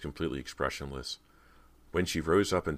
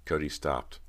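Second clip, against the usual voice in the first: ordinary delivery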